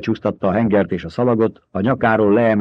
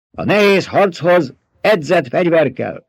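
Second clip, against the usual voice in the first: shouted; 9 semitones higher, 4 dB louder than usual. A narrator's reading. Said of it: "kiáltotta egy hang".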